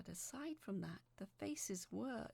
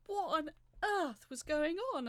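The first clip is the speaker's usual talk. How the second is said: startled thought; 7 semitones higher, 8 dB louder than usual. A narrator's reading. Struck hard underwater, bewildered.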